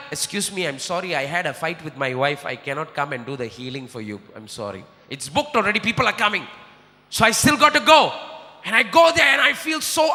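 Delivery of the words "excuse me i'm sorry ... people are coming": much softer and lower, apologetic; sheepish apology. Retold or imitated. Preacher imagines calling hosts to cancel, quietly sorry. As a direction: murmur an embarrassed excuse. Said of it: "I cannot call them and tell".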